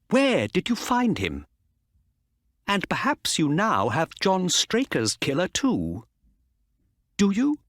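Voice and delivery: eager Mister Ross. A narrator's reading. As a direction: press him for answers, excited.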